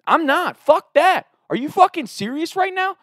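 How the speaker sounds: high, heated retold outburst; blowing up at the dean, voice pitched high; going off. Student cussing out the dean, heated outburst. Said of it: "I'm going off".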